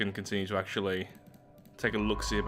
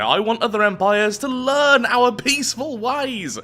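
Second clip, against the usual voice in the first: high-pitched, playful grand declaration; mock proclamation; 8 semitones higher, 4 dB louder than usual. Gamer jokes about spreading peace by warfare, mock proclamation. Mock-noble call to war.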